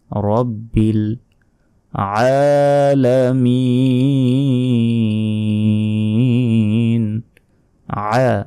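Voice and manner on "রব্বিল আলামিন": slow "রব্বিল", then "আলামিন" stretched long from the throat; tajweed elongation. Shown as a demonstration. Teacher demonstrating the long throaty আলামিন. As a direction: draw "আলামিন" out with a throaty আইন. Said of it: "আইন অক্ষর ... টেনে পড়তে হবে".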